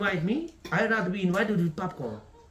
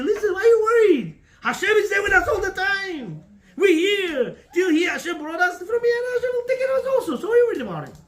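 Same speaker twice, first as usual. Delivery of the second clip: higher, louder rousing faith; fervent encouragement; 9 semitones higher, 5 dB louder than usual. Acted. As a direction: lift him up with loud conviction. Wife reassuring husband about job.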